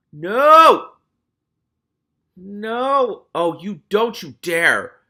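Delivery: high, loud no no; real horrified outcry; horrified protest. Real, not acted. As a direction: plead with the book, loud. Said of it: "reader's own protest".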